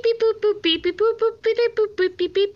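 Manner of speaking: high, even sing-song beeps and boops; dial-tone beeps. Pretend. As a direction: imitate a phone line with rapid little beeps. Imitating a phone line's tones.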